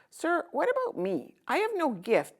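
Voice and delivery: put-on high, hesitant child voice; little girl. Girl asking the king to adopt her, high and timid. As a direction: ask timidly.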